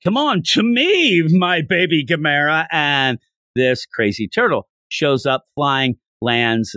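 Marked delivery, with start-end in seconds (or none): 0.0-2.7 calling out. Retold or imitated. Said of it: "he yells out".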